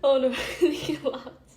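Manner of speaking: loud, laughing outburst. Real, not acted. Reader losing it over a relatable story, real laughing. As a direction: blurt it out through laughter.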